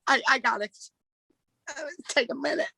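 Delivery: words slipping out as tears come; choking up. Real, not acted. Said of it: "trying not to get emotional".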